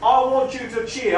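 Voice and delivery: usual speaking voice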